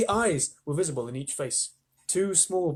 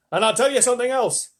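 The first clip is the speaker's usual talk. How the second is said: sharp; 8 semitones higher, 7 dB louder than usual. A narrator's reading. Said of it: "the centipede said sharply".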